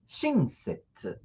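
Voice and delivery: "shinsetsu" stretched slowly, syllable by syllable, falling at the end; slow Japanese word. Shown as a demonstration. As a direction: model "shinsetsu" slowly for the students. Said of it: "Shinsetsu significa alguém que é gentil". ("shinsetsu" pronunciado devagar, caindo no fim)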